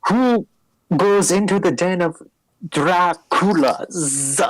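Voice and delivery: high, drawn-out mock-spooky vampire cry; hammed-up vampire. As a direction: ham up the vampire larpers. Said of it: "they say".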